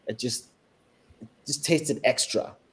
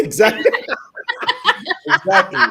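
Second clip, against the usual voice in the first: laughing high and loud; 8 dB louder than usual. Real, not acted. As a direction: laughs through the word exactly. Laughing at the joke.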